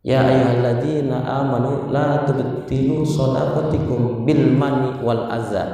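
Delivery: slow, measured Arabic recitation; Quran recitation. Real, not acted. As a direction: recite the verse slowly and low. Preacher recites a Quranic verse. (membaca ayat Al-Quran perlahan)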